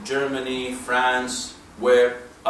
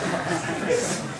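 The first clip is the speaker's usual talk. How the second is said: a quick real chuckle at his own joke; short amused laugh; 2 semitones higher, 4 dB quieter than usual. Real, not acted. To the audience after the test drive joke, an amused laugh. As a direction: laugh lightly, then move on.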